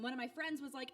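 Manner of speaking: ordinary delivery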